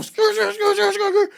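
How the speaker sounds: high tire screech noises, repeated; squealing skr skr sound effect, way up high; screeching rims. Retold or imitated. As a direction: imitate metal rims scraping the road. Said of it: "rims just sparking up the street".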